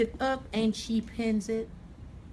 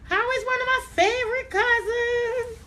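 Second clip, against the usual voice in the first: much higher, louder, slow and stretched; delighted sing-song greeting; 13 semitones higher, 5 dB louder than usual. Real, not acted. Stylist spotting her cousin Joan in the chat, sung-out higher greeting. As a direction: sing out hello to a favourite cousin.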